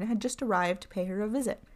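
plain reading voice

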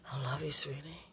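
soft whispered endearment; whispering. Real, not acted. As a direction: breathe it lovingly.

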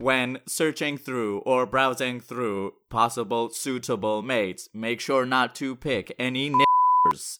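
robotic synthesized speech, evenly paced; computer voice. Acted. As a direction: speak like a talking computer.

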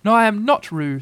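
high, insistent little-animal voice; small creature insisting; loud protest. A narrator's reading. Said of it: "said Piglet loudly".

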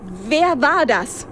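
slow, pointed snap at him; sharp demand. A narrator's reading. Narrator rounds on the old man, slow sharp demand. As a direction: demand an answer, very slowly. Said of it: "fuhr ich den Alten an".

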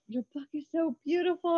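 high, gushing admirer; gushing fan. Retold or imitated. Author recalling readers praising her book, gushing. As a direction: gush over the book like a fan. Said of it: "Everyone's always like".

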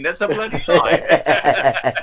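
drawn-out mock accusation breaking into laughter; Michael Caine. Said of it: "mention Michael Caine".

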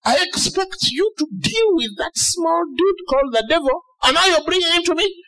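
high-pitched mock indignation; incredulous God. God scolding a believer who reports the devil, high mock disbelief. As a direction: voice God taking someone to task, pitched high. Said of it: "if God is like".

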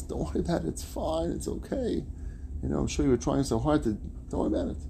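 soft, high, soothing let-it-go voice; playing the endlessly patient man; acted reassurance. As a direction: gently excuse a clumsy mistake. Same man forgives second spill.

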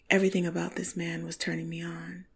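usual speaking voice

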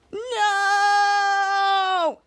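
long, high, held-out wail; melodramatic cry of no. Acted. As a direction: hold the word on one high note. Captain's dramatic cry.

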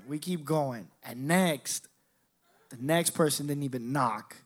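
normal talking voice

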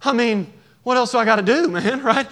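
loud, high and fast complaint of a fed-up father; exasperated dad. Retold or imitated. Impatient father grumbling to himself, loud and exasperated. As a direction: throw up your hands, annoyed.

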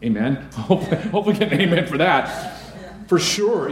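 laughing through the words; amused chuckle. Real, not acted. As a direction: chuckle while nudging the congregation for an amen. Jokes when congregation gives no amen.

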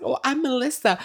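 high-pitched mocking imitation; Melissa. Telling on the sister-in-law, high mocking voice. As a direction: mimic her in a squeaky put-on voice. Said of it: "she was just like".

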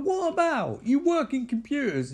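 very high mock-exasperated challenge; furious. Girlfriend questions boyfriend's documentary plan, mock furious. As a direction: challenge him in a high, imitated outrage. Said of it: "she's furious".

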